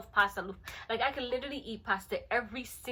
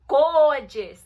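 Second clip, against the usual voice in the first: GORGEOUS punched loud and drawn out; gushing emphasis; 3 semitones higher, 10 dB louder than usual. Real, not acted. Describing her great-grandmother to viewers, gushing. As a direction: hit "gorgeous" hard and stretch it.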